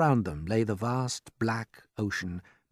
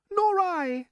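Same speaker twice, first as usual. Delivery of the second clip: very high dainty voice; ladybird voice; 4 dB louder than usual. A narrator's reading. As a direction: pipe it up like a tiny ladybird. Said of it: "the ladybird said".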